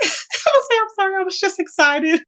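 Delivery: high, giggly mock apology; laughing. Real, not acted. Speaker rehearses an apology for the neighbors, laughing. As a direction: squeeze the apology out through laughter.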